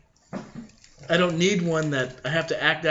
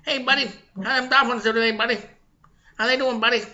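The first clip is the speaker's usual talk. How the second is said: high-pitched pestering buddy voice; Boston coworker impression; 8 semitones higher, faster than usual. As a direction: imitate the guy in your face. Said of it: "That's how he talks".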